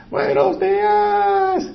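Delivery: very high, slow singsong "Buenos días"; sing-song greeting. Retold or imitated. Women on the street calling to the preacher, high singsong. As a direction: stretch the greeting high like a cheery caller. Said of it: "ellas me gritó".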